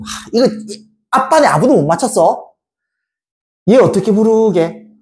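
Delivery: ordinary talk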